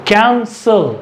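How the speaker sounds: कैंसल split as CAN, SAL; syllable by syllable. Shown as a demonstration. Teacher showing first and last syllable, कैंसल broken in two. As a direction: say कैंसल in two distinct beats, falling on SAL. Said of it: "last syllable कौन सा हो गया".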